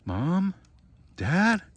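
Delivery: high, rising call for mom and dad; anxious calling. Retold or imitated. Boy calling his parents in the dark, high and anxious. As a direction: call out, getting scared.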